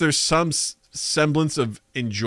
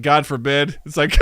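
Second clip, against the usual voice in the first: ordinary delivery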